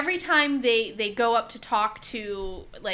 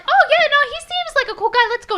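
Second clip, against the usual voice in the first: very high, louder hammed-up eagerness; breezy naive enthusiasm; 7 dB louder, faster than usual. Mariner waving off worries about the Ferengi, naive enthusiasm. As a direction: chirp it with exaggerated, carefree trust. Feigned naive trust, retold.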